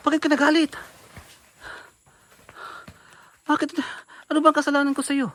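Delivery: shouting at the woman who attacks him, panicked; alarmed shouting. Real, not acted. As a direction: yell at her to stop. Shouting while being hit.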